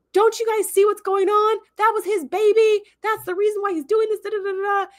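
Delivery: high-pitched mock-desperate protest; acting out a frantic woman's high protest; imitated frantic plea. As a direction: plead with the crowd, voice pushed way up. Restrained woman pleading with crowd.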